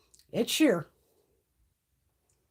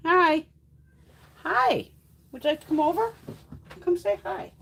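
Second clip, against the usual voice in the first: high, fast, louder cooing to a cat; pet talk; 9 semitones higher, 6 dB louder than usual. Real, not acted. Inviting her cat to greet viewers, high sing-song pet talk.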